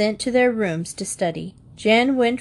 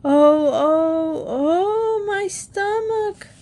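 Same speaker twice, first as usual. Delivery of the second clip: much higher, slow wailing groan; loud fake groaning; 11 semitones higher, much slower than usual. Boy trying to convince mother he is sick, wailing. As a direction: wail it out like dying. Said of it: "yelled louder than ever".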